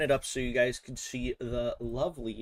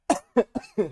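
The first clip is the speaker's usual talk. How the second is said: choking cough; 9 semitones higher, 7 dB louder than usual. Real, not acted. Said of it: "Choking on my spit".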